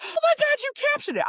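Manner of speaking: very high, loud delight; thrilled to see the footage, a high squeal; excited squeal. Retold or imitated. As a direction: squeal like you can't believe it. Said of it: "I'm like".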